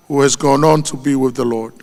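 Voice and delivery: ordinary talk, nothing marked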